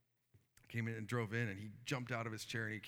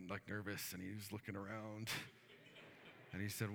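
ordinary talk, nothing marked

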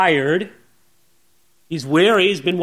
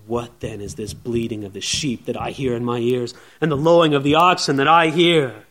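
cold stern Samuel; 8 semitones lower than usual. Retold or imitated. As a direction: ask it with cold steel. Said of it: "cold steel in his eyes".

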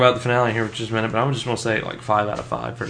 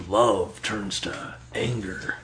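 trailer announcer; 7 dB quieter, much slower than usual. Retold or imitated. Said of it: "the typical C W announcer".

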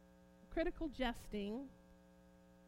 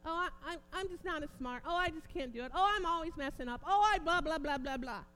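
mimicking self-critics, higher pitched, building louder; mock complaint swelling into dismissive blahs; mocking self-deprecation; 4 semitones higher, 8 dB louder than usual. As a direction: parody someone running themselves down. Mimics people running themselves down.

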